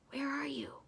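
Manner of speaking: high, soft whisper. Acted. Roger whispering into the room, hushed. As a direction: whisper the question through the window. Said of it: "he said softly".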